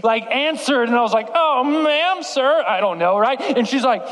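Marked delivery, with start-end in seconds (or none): 1.3-3.2 high, startled stammer; mock-flustered little boy; flustered kid. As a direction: fumble the words in a panic.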